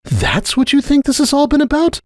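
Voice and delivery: high, incredulous question. Acted. Starlight disbelieving Cupcake's view of Sunburst, high incredulous. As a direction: let the disbelief burst out. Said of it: "giving free rein to her incredulity".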